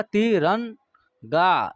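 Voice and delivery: तिरंगा drawn out very slowly, every syllable clear; teacher modelling तिरंगा for the class, very slow; slow careful demo. Shown as a demonstration. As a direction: stretch out तिरंगा so pupils hear the half n. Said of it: "इसका उच्चारण न का होगा".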